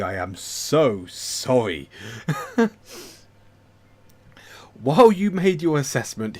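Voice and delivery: ordinary delivery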